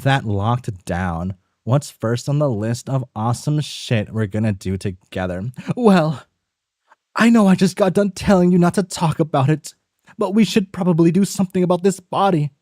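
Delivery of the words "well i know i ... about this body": higher and fast, urgent; a hurried, nervous accomplice pushing to act; brisk high character voice. Acted. A nervous partner urging the other to deal with the body, high and fast.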